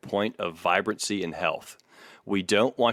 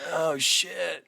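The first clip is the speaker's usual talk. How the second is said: gnarly hippie; 8 semitones higher than usual. Retold or imitated. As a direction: play the dopey hippie caught out. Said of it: "gnarly hippies showing up".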